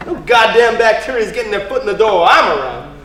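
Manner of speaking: loud, excited swagger; loud and gung-ho; fired-up boast. Acted. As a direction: boast it with gusto. Eager bodyguard boasting to his boss.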